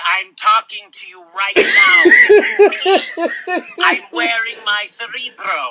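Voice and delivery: loud, laughing toy voice; a doll breaking into laughter; pull string toy voice. Pretend. Doll voice talking to Andy.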